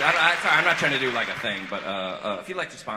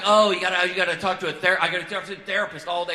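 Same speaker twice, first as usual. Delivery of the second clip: loud, higher-pitched sarcastic impression; mock envy; 6 semitones higher, 12 dB louder than usual. Friend's wife to man in rehab, mocking envy. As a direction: throw the complaint back with heavy sarcasm. Envious mother mocks rehab complaint.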